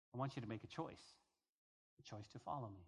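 quick, very soft, low plea; God tenderly urging a choice. Acted. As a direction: draw them in with a hushed invitation. God voiced pleading for a choice.

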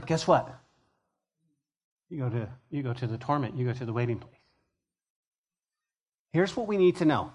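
usual speaking voice

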